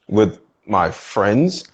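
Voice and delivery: sheepish mumble. Retold or imitated. Husband answering sheepishly.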